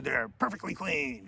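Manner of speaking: lightly put-on fussy sergeant voice; imitating sergeant. Sergeant inspecting every cadet's fingernails and toenails, mock inspector voice.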